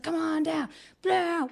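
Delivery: put-on high, hooting drunk party guy; rowdy drunk. Drunk boyfriend coaxing her from the bar, rowdy whoop.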